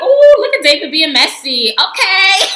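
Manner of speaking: hammed-up delight at the drama; mock messy gossip. Her own gossipy reaction.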